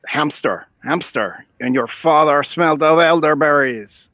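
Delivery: hammed-up French accent, Monty Python style; French taunt accent. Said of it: "practicing my French accent".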